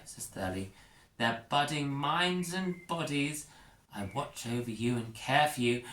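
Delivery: lightly voiced prim teacher. Teacher Thurley speaks.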